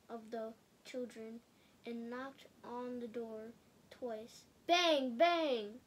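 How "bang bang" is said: loud knocking. A narrator's reading. Wolf knocking on the door.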